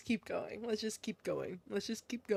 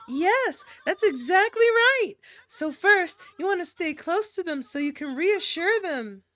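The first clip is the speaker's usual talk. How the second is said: put-on eager girl character; 2 semitones higher than usual. Voices a game character's first-aid answer.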